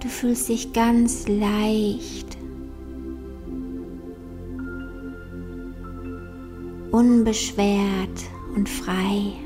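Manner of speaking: slow, soft meditation voice; guided meditation. Acted. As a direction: guide them gently into calm, slowly.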